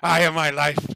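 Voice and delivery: dwarf cooing loudly at his pet bear; gushing. Acted. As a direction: gush over a beloved pet. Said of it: "gushing over his pet bear".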